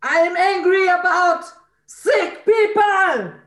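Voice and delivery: high, loud, drawn-out outburst; real angry shout. Real, not acted. Participant asked what she is angry about, furious shout. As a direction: shout the grievance out slowly. Voicing anger when prompted.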